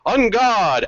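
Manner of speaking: lightly mocking, high-pitched challenge; mocking battle cry. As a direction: echo the hero's cry with mild mockery. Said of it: "you hear a mocking tone".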